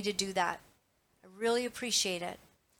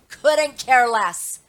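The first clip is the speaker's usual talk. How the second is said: slow, much louder, dragged out; dismissive; 10 dB louder, much slower than usual. Real, not acted. To online critics, a slow loud brush-off. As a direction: lean on every word, you're done caring. Shrugging off being called names.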